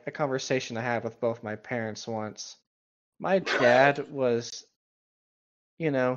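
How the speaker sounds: usual speaking voice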